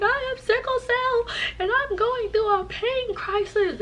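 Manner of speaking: high, drawn-out mock wail; hammed-up crying confession; imitated bawling. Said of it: "So I was crying, bawling".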